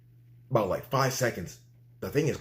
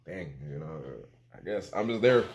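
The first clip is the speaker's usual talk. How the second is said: low, soft, offhand shrug of a voice; nonchalant; 9 semitones lower, 4 dB quieter than usual. Retold or imitated. Patient recalling almost dying, quietly nonchalant. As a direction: let it drop, quiet and unbothered. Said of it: "like, nonchalantly, I was like".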